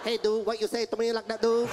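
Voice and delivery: mock Vietnamese-accented challenge. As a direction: confront someone in a caricatured Vietnamese accent. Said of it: "they talk real fast".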